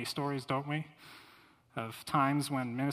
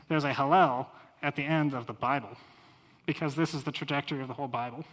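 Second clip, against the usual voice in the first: normal talking voice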